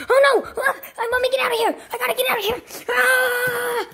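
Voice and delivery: high, frantic yelling; panicked fleeing. Acted. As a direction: scream while scrambling to escape. Mario flees in panic.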